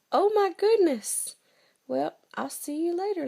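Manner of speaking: high, fast nervous exit; alarmed fox. Acted. Fox hearing he is the gruffalo's food, high and fast. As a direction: squeak it and hurry away.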